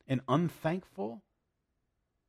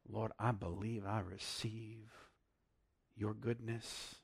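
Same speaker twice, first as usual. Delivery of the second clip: hushed prayer; 5 semitones lower, 10 dB quieter than usual. Acted. Preacher prays softly.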